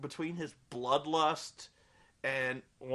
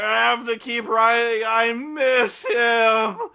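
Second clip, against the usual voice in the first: mock desperate plea; 4 semitones higher, 10 dB louder, much slower than usual. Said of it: "And he's like".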